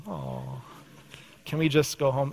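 put-on soft, whiny child begging to leave; whiny kid plea. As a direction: whine it like a tired kid. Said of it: "first service said that too".

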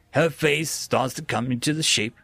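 plain reading voice

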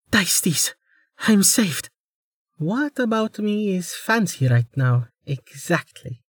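high, pleading girl playing injured; a young woman faking helplessness, high and breathy with need; pitiful young woman. Amelia's voice in the story.

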